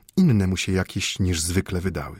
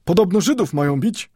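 high, foolish smirking tone; silly grin; 11 semitones higher, 4 dB louder than usual. Acted. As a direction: drop the news with a stupid grin. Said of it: "z głupkowatym uśmiechem".

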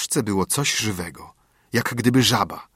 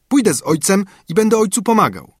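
high-pitched, louder voice of a willing son; eager son; 10 semitones higher, 6 dB louder than usual. A narrator's reading. Son offering to help father at work, eager high voice.

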